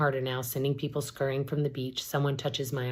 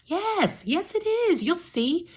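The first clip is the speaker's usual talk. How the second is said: much higher, sing-song insistence; giddy insistence; slower than usual. Acted. Sister on the cliff brushing off her warning, elated.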